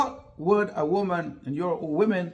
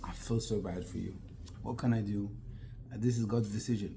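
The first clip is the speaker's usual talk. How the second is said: much lower and softer, gentle and caring; tender sympathy; 8 semitones lower, 9 dB quieter than usual. Shown as a demonstration. Husband gently comforting his grieving wife, tender and soft. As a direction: comfort her softly, as a loving husband would. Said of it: "So say to your wife".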